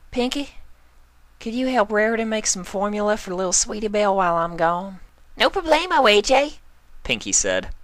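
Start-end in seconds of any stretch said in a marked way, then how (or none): 0.1-5.0 high, bright pony voice; Applejack asking. Acted. Applejack asking Pinkie for help, high. 5.4-6.6 very high, loud and chirpy; bouncy Pinkie. Acted. Pinkie answering Applejack, very high and loud.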